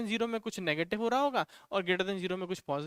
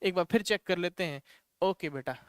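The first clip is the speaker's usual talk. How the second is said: ordinary talk, nothing marked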